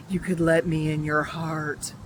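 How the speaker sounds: lightly voiced sinister friend, dropped from the pleading pitch; creepy ghost request. Ghost posing as a crying friend asks Bella, mildly creepy. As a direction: drop down and make the request eerie.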